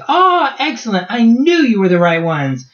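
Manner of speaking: high, exuberant welcome; delighted greeting. Acted. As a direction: burst out high in delight, then ease down. GM voices Fernando greeting returning party.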